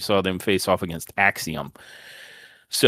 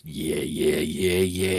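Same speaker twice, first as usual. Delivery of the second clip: low, menacing murmur; low creepy voice; 6 semitones lower, 5 dB quieter, much slower than usual. Retold or imitated. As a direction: creep away with a low taunt. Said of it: "he's like".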